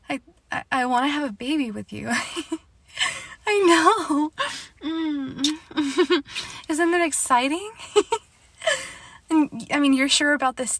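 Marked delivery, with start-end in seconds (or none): 2.1-6.2 teary laughter. Acted. Happy tears over starting family. 6.5-8.0 laughing excitedly. Acted. Overjoyed at baby decision.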